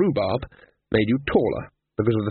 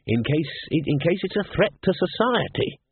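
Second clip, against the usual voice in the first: higher-pitched, self-righteous afterthought; virtuous; 7 semitones higher than usual. A narrator's reading. Dean excuses going to listen, virtuous. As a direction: justify it as a public duty. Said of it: "he added virtuously".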